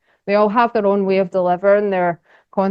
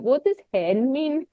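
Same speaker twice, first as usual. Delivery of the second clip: puzzled American; 6 semitones higher, 4 dB quieter, slower than usual. Retold or imitated. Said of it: "Americans are like".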